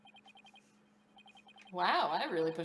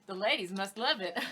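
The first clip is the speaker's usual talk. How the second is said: usual speaking voice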